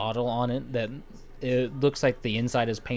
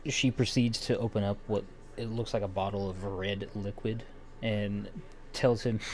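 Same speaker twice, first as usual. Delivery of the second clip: usual speaking voice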